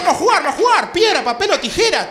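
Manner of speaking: a gleeful high-pitched cartoon chant; excited cartoon girls. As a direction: shout it like hyped-up cartoon heroines.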